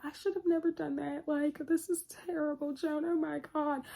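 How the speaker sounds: high, anxious rush of guilt; guilty high-pitched fretting. Retold or imitated. Joan fretting to herself, high and guilty. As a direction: fret like you were caught. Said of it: "She's like".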